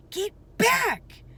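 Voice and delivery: loud high alarmed shout. A narrator's reading. Jack shouting at Annie near the Triceratops, loud alarm. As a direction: bark the warning at her. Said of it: "Jack shouted at her".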